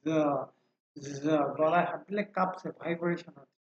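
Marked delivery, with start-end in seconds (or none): 0.0-1.4 যা with a buzzing z held before the vowel; voiced sound. Shown as a demonstration. Teacher demonstrates vocal cord vibration, voiced যা. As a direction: hum the z into যা. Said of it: "প্রথমটা কিন্তু voiced".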